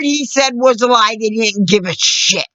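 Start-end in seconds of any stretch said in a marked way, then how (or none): none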